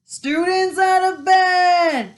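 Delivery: loud, high, drawn-out alarm call; bellowing. A narrator's reading. Peeves raises the alarm on pupils, bellowing. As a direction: holler it down the corridors, every word stretched. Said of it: "Peeves bellowed".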